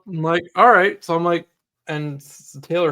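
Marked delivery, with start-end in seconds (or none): 0.5-1.0 excited all right. Retold or imitated. Excited reaction to sale.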